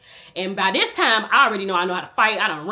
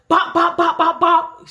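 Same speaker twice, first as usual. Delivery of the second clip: higher, louder bop bop of punches; punch sound effects; 9 semitones higher, 7 dB louder, slower than usual. Retold or imitated. Sister beating the girl, punching out loud sound effects. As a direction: hit each bop like a landing punch.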